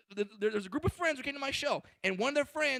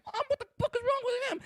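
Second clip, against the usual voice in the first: high-pitched mock panicked child; freaking-out kid. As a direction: squeal it to mom as a kid losing it. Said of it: "He's freaking out like".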